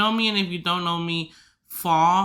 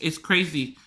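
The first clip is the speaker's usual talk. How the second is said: usual speaking voice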